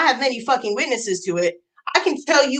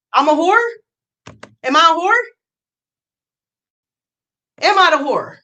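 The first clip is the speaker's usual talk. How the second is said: heated question; 4 semitones higher, 4 dB louder than usual. Real, not acted. Angry rant repeating accusation.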